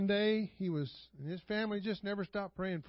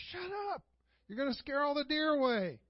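a high, snappy retort from the tree stand; a hint of an irritated hunter shushing; annoyed dad; 7 semitones higher than usual. Father hunting in a tree stand snaps at his hollering brother, high and irritated.

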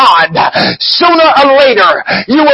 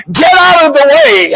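pitched up, pressing the line home; rising exhortation; 6 semitones higher than usual. Real, not acted. Preacher urging believers to stop interfering with God, raised exhortation. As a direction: lift it high and drive the point.

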